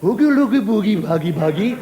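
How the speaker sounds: mock baby talk, swooping pitch; silly cooing at a baby. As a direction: coo at the pram like a doting adult. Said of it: "everybody used to come and go".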